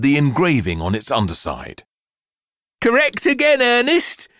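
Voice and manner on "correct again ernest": mother's high, loud forced cheer; high-pitched, put-on brightness for her son; forced enthusiasm. A narrator's reading. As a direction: muster all the enthusiasm you can. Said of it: "with as much enthusiasm as she could muster".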